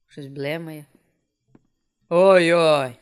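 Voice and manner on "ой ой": loud drawn-out startled oy. Real, not acted. Player caught off guard in the dark, startled. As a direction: exclaim oy in alarm.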